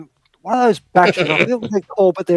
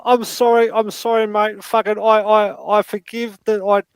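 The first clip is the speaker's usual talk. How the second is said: apologising dad; 4 semitones higher, 3 dB louder than usual. Pretend. Role-play dad apologising.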